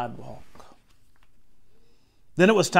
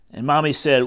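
usual speaking voice

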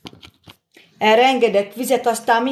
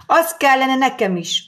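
usual speaking voice

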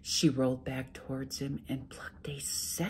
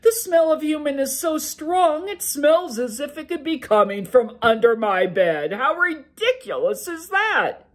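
big, loud, booming ogre, much higher pitched; a sleepy giant ogre grumbling to his wife; loud ogre brushing off his wife's worry; 11 semitones higher, 11 dB louder than usual. Acted.

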